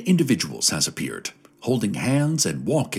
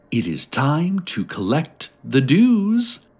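slow, higher, drawn-out sigh of a line; mild sighing tone; 7 semitones higher, 3 dB louder, slower than usual. A narrator's reading. Serene group asking the crowd for dues, gently sighing. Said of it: "they sigh".

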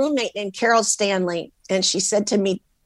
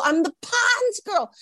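much higher and slower, "Pond's" sung out with delight; drawn-out happy brag; giddy singsong; 12 semitones higher, slower than usual. Retold or imitated. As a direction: repeat it, savouring every word. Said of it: "I called everybody in Portland".

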